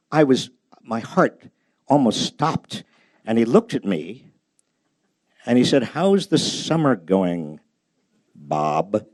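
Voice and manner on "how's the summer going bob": lower, slow Kennedy greeting; a lightly voiced senator asking kindly; Robert F. Kennedy. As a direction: drop low and take it slowly, as the senator. Kennedy greets him by name.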